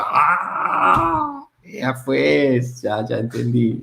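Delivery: long high "Ah" settling back down; dawning realization. Retold or imitated. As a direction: re-enact the penny finally dropping. Acts out his moment of realization.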